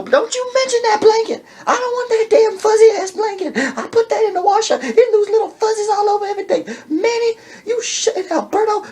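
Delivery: very high, loud, laughing-crying rant; high shrieking rant. Retold or imitated. Wife yells at Manny and Alberto, very high and loud. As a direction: shriek it at everyone. Woman voiced, shouting.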